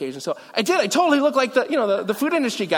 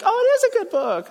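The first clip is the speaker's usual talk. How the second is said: much higher, sing-song pretend change of heart; mock future self; 4 dB louder than usual. Pastor mocking his future self praising the book, high and sing-song. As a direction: voice his own future self, surprised and gushing.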